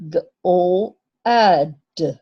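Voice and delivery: G O A D sounded out slowly, letter by letter; slow letter sounding. Shown as a demonstration. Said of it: "underline the letter that makes the A sound".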